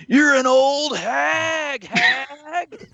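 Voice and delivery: high, drawn-out mock scream; imitated heckler scream. Guy in the crowd screaming at Vicky Guerrero, imitated heckling scream. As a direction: screech it slowly like a heckler in the crowd. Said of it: "a guy behind us screaming at Vicky".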